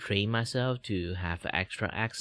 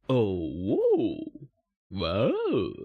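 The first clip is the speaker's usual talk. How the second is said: a louder, swooping exclamation; pitch swings; 4 dB louder, much slower than usual. Shown as a demonstration. Showing how the voice jumps around, a swooping whoa. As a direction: let the whoa swing between high and low. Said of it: "I go high and low".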